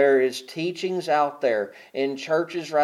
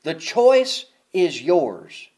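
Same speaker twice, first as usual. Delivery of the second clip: slow emphatic repeat; 3 semitones higher, 6 dB louder, much slower than usual. Acted. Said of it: "Y'all don't miss that".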